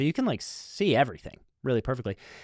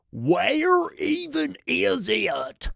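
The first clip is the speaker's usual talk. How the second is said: imitating a slurred, slow, worn-out partier, higher; wrecked drunk voice; 7 semitones higher, much slower than usual. Drunk fan asking the narrator, lightly voiced wasted slur. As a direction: drag it out like a guy up all night on cigarettes and beer. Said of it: "It sounded like he'd been up all night".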